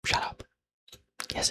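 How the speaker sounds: ordinary talk, nothing marked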